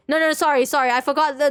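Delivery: ordinary delivery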